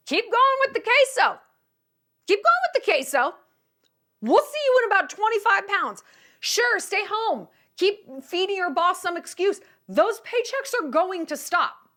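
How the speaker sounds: high, loud mock warning; scolding rant. Comic warning open-marriage boasters, high scolding rant. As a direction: shout a comic warning of what's coming.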